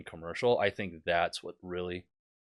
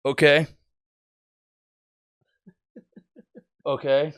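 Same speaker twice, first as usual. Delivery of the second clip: imitated video pilot; 5 semitones higher, 6 dB louder than usual. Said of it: "just him breathing and looking out the window".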